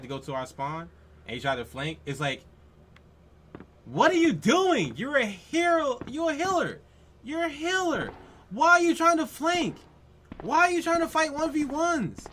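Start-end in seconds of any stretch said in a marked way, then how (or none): 3.8-12.3 high, loud exasperated shouting at the player; angry yelling. Real, not acted. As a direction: blow up at him, fast rising rage. Ranting at a flanking healer.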